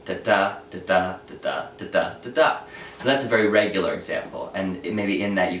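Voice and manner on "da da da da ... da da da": "da da" repeated with the second "da" stressed each time; iambic beat. Shown as a demonstration. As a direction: chant the iamb, da-DUM. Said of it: "each foot is an iamb".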